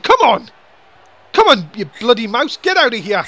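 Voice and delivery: loud, fed-up shout at the mouse; exasperated yell. Real, not acted. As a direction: bark it out in real annoyance. Commentator cursing the interfering mouse.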